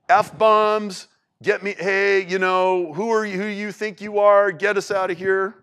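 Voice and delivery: a hammed-up angry thief snapping; mocking thief. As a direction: jeer like the scoffing criminal.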